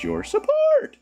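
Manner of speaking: mock voice pop. Streamer playing up his voice pop while thanking Dennis, squeaky. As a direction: jump into a squeaky end. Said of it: "My voice got".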